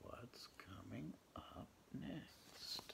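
whisper. Real, not acted. Whispering to child before page.

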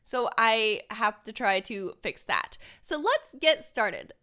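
usual speaking voice

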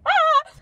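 short, loud high squeal; high-pitched fangirl shriek; swooning squeal. Acted. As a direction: squeal with delight. Squeal over the tall crush.

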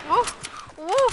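loud high celebratory whoop; victory whoop. Real, not acted. Gamer celebrating a kill, loud whoop. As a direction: cheer out loud like you just won.

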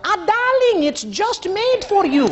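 high, syrupy sales flattery; gushing saleswoman. Retold or imitated. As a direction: flatter like a pushy shop clerk. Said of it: "them vultures to talk".

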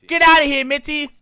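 shouted get-lost at the roommate; loud shoo. Acted. Chasing the roommate off mid-date, loud shout. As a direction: bark her out of the room, loud and high.

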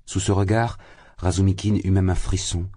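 ordinary delivery

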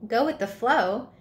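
puzzled, questioning repeat of the phrase; incredulous echo. A narrator's reading. Spot confused by Flutterby's advice, incredulous. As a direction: throw the words back in disbelief. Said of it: "Wait, what?".

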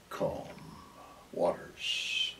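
very soft, with a long pause between words; hushed calm. Shown as a demonstration. Preacher picturing waters that are not roaring, hushed calm. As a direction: let "calm waters" sink almost to a whisper. Acting out still, placid waters.

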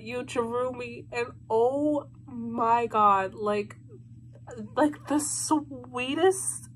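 soft, slow, moved; hushed and emotional; touched. Real, not acted. Touched by fan drawing.

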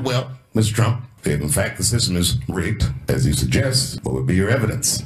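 low, measured moderator voice; debate moderator. Retold or imitated. Comedian playing the moderator questioning Trump, low official voice. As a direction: drop low for a formal question. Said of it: "And the moderator said".

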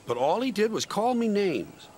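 high, aggrieved complaint; hurt protest. A narrator's reading. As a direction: protest with injured pride. Rusty protests, hurt at insults.

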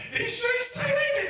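high coaxing voice. Retold or imitated. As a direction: voice climbs way up, coaxing. Fishing for compliments from sons.

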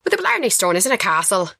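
put-on casual Irish accent, a bit quick. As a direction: slip into a light Irish lilt. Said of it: "That's pretty good".

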